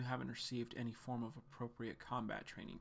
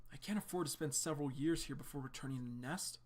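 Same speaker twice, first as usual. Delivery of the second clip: ordinary delivery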